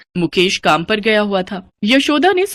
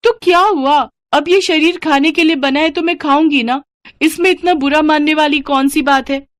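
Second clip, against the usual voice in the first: defiant greedy wife; 7 semitones higher than usual. Acted. Sunita answering her husband's reproach, brazen and defiant. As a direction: shrug off the blame boldly.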